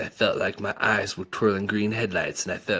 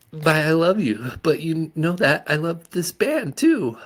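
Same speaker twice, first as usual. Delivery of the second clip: defiant mom; 9 semitones higher, 6 dB louder than usual. A narrator's reading. Mom defending herself to son.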